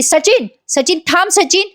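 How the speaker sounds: mock shout after departing husband; calling out loudly. A narrator's reading. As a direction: shout his name to stop him. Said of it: "मोठ्याने ओरडत असते ती".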